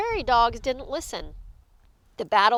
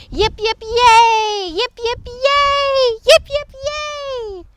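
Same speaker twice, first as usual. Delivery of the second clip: high, gleeful crowd cheer; three cheers; 11 semitones higher, 7 dB louder than usual. Acted. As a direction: chant hurrahs with loud final yells. Three cheers for Big Bark.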